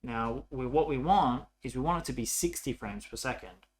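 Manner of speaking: ordinary delivery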